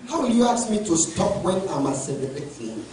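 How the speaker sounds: normal talking voice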